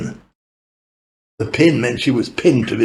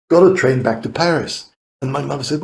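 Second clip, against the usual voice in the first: ordinary delivery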